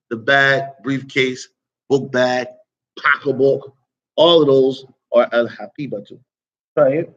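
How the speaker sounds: usual speaking voice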